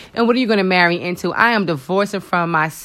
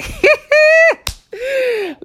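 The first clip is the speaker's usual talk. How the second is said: big high shrieking laugh, suddenly loud; loud burst of laughter; 3 dB louder than usual. Real, not acted. Laughing at herself about not knowing how to edit, loud shrieking laugh. Laughs at her own editing.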